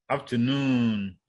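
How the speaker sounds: afternoon slowly, NOON drawn out; stress on third syllable. Shown as a demonstration. Teacher giving a third-syllable example, afternoon stressed on NOON. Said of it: "on the third syllable".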